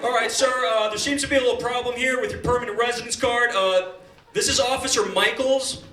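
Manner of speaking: loud officious officer voice; airport officer. Retold or imitated. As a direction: play the stiff immigration officer.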